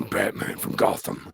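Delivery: raspy put-on Batman whisper. Mocking the horror YouTuber as a raspy Batman. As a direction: growl like a gritty Batman. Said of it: "It's like".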